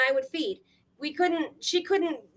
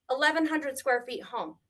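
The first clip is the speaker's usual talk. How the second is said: ordinary delivery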